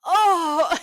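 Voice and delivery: high, loud imitated wailing "Oh" through laughter; mock wail. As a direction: ham up his anguished cry. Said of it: "the sound that came out of him".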